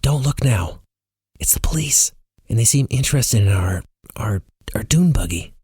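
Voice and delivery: urgent high-pitched warning, partly whispered; nervous hushed aside, faltering at the end; harsh whisper. A narrator's reading. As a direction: warn them under your breath. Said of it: "she whispers harshly, leaning in".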